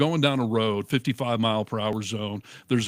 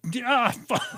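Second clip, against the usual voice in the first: mock dismay; 12 semitones higher than usual. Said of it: "I was like".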